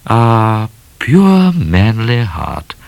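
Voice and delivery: slow, drawn-out romantic sigh; swooning lady. Acted. Miss Laura admiring shy Midnight, swooning lady. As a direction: stretch it out adoringly.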